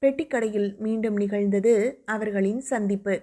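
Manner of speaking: usual speaking voice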